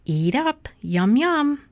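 lilting wife urging food on someone; sleep-talking wife. A narrator's reading. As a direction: pitch it up, singsong and encouraging. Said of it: "Missus Watson said in her sleep".

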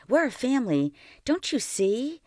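put-on high, pleading boy's voice. A narrator's reading. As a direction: plead with her, voice rising high. Boy pleads against losing grandfather.

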